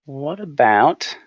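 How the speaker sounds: ordinary delivery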